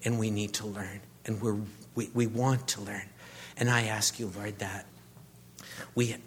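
hushed closing prayer. Real, not acted. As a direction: pray it softly, earnestly.

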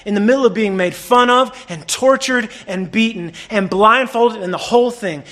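usual speaking voice

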